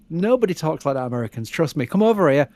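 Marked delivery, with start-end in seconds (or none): none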